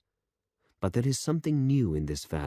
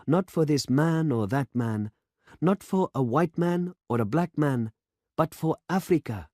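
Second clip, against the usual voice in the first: put-on young demonstrator, proud; 9 semitones higher, 3 dB louder than usual. A narrator's reading. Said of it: "said the young man".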